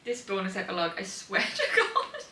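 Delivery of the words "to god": laughing disbelief. Real, not acted. Laughing at the bonus epilogue.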